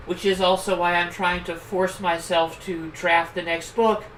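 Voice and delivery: ordinary talk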